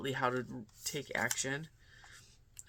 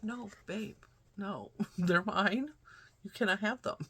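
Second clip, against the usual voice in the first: teasing refusal; 6 semitones higher, 3 dB louder than usual. Retold or imitated. Mom refusing son, teasing.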